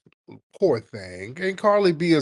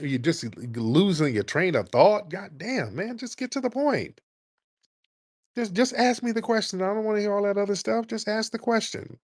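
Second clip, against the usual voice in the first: exasperated; 7 semitones higher than usual. Real, not acted. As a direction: plead with them to get to the point. Exasperated at rambling callers.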